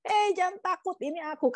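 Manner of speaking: high-pitched acted reassurance; calling back. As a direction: call out quickly to calm frightened friends. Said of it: "jawabnya seperti itu gitu kan".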